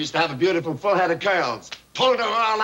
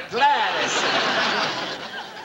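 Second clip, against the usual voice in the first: very slow, stretched "Glad to see you" with a laugh; drawn-out greeting; much slower than usual. Acted.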